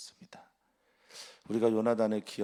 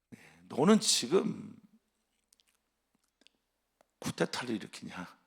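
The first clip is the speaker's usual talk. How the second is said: angry accusation; 2 semitones higher, 6 dB louder than usual. Acted. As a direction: demand it like a suspicious king. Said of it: "이렇게 말하는 거예요".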